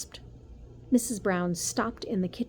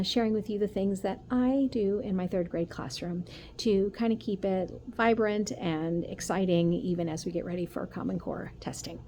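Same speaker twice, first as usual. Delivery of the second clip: ordinary delivery, nothing marked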